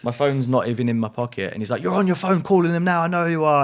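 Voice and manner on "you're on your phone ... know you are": higher, louder, certain accusation; paranoid accusation. Retold or imitated. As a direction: insist he's calling them. Paranoid friend accuses him.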